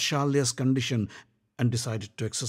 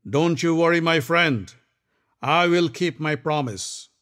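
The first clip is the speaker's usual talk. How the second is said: louder, confident warrior; Karna's voice; 5 semitones higher, 4 dB louder than usual. Acted. Karna reassuring Duryodhan, confident. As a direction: reassure a friend boldly.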